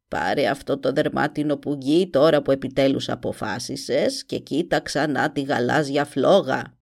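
put-on low, grim old witch. A narrator's reading. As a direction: croak it like a sinister witch. (φωνή μάγισσας)

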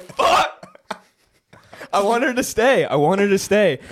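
imitating Sean. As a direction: cry it out in mock torment. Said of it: "And Sean's like".

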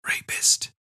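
breathy whisper into his ear. A narrator's reading. Narrator whispering an accusation into her captor's ear. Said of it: "I whispered".

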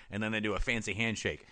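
normal talking voice

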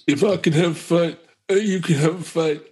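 high-pitched mock film-hero speech; Rocky. Host parodying the movie's ending, high mock-rousing impression. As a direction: send up a boxer's rousing victory speech. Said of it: "what was the ending of that movie".

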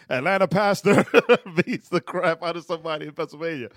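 laughing mock news announcement; mock headline. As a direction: proclaim a scandal headline, cracking up. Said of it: "Then the headline was".

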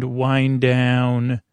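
normal talking voice

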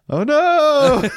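high loud shocked yelp; shocked cry. Retold or imitated. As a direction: cry out in alarm. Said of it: "he was like".

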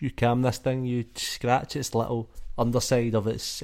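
normal talking voice

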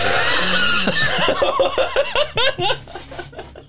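buzzing lips like a jet taking off; lip buzz airplane. Pretend. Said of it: "that was my impression of an airplane taking off".

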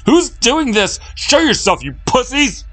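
very high, loud challenge; high-pitched, full-force taunting shout; furious shout. Acted. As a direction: yell at an unseen tormentor. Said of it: "he shouted at the ceiling, raising a fist".

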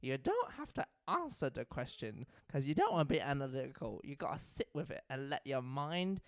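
soft, low mock British therapist; attempted British accent. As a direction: guide gently in a put-on British accent. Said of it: "I'm trying to do British".

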